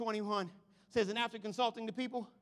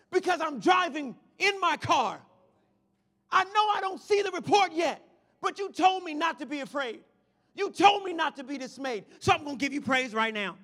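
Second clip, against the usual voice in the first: full-force preaching; 3 semitones higher, 6 dB louder than usual. Real, not acted. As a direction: drive it home to the congregation.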